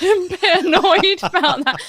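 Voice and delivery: laughing through the words. Real, not acted. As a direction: chuckle as you say it. Laughing at partner's annoyance.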